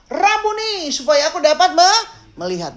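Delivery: high, insistent plea; pleading cry. Acted. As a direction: cry it out high, begging to be granted it. Repeats blind man's plea. (seruan memohon bernada tinggi)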